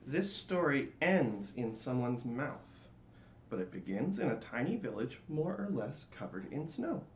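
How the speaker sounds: usual speaking voice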